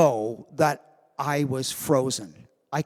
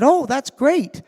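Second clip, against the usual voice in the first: mock gushing parents thanking their son; delighted parents; 6 semitones higher than usual. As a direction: gush like proud parents. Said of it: "and they said".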